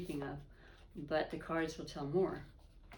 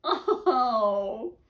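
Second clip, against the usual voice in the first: a bright, loud laughing "Oh"; moved laugh; 7 semitones higher, 11 dB louder than usual. Real, not acted. Reader moved by the Two of Cups card, laughing out loud. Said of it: "it makes me wanna cry".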